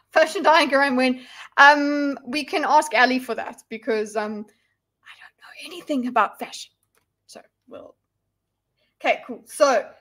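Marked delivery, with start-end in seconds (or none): 5.0-7.9 whisper. Real, not acted. Confessing ignorance as aside.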